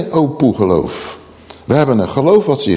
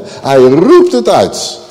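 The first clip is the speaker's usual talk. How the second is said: slow, louder burst on 'roept'; crying out; 4 dB louder, much slower than usual. Shown as a demonstration. Preacher showing the exclamation mark, crying out. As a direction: cry it out, stretching 'roept' into an exclamation. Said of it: "Uitroepteken aan het eind van de zin".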